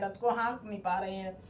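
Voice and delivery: a hushed echo of the line. Shown as a demonstration. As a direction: let the repeat sink quietly. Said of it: "repeats line for emphasis".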